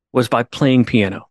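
normal talking voice

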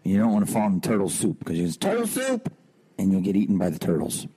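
normal talking voice

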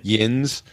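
"Yins" held briefly with a rising end; short i and buzzed z in Yins; Pittsburgh "Yins". Shown as a demonstration. Guest repeats Pittsburgh's big word, local pronunciation of Yins. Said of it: "That's their big thing".

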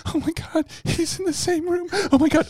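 high, hushed panic; acted frightened squeak, kept soft; terrified caller. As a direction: play hiding from an intruder, voice way up and small. Hiding caller, intruder in room.

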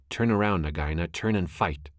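ordinary delivery, nothing marked

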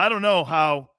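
ordinary talk, nothing marked

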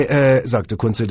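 usual speaking voice

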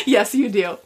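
re-quoting through laughter; laughing retelling. Retold or imitated. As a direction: toss off the line still giggling. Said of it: "And she laughs".

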